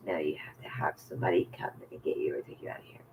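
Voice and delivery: hushed retelling of staff refusal; whispered refusal. Retold or imitated. Staff refusing release, retold.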